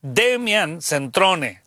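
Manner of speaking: very slow, careful name reading; spelling it out. Shown as a demonstration. Host giving listeners the author's name, slowly sounded out. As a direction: sound out "Demian Centrone" slowly. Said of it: "lo estoy deletreando".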